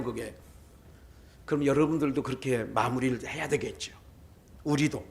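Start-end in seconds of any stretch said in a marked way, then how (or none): none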